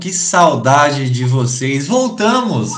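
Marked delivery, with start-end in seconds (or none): 1.9-2.7 animado. Real, not acted. Host announces podcast is back.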